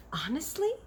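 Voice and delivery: high, amazed little question; a girl's astonished question; surprised girl. A narrator's reading. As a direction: gasp it out in wonder. Sophie voiced in a story.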